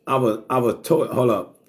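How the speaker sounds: ordinary talk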